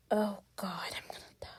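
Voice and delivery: barely audible, voiceless whisper; dread-filled whisper. Real, not acted. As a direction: whisper it as if doomed. Dreading the next game stage.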